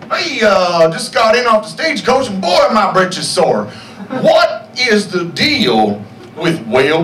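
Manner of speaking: put-on hammy old-timey cowboy comic voice; hammed-up Old West stand-up; Old West comedian. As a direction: play a corny frontier stand-up working the room. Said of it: "I'm gonna do a character for you guys".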